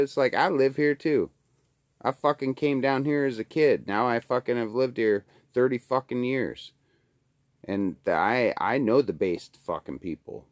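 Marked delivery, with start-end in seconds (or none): none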